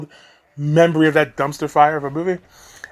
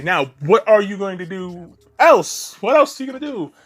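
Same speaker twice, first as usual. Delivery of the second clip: usual speaking voice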